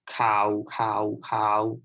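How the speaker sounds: "Cow" said low as Thai for news. Shown as a demonstration. Teacher showing Thai khao meaning news, low pitched. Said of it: "pronounce the word K A O in five different tones".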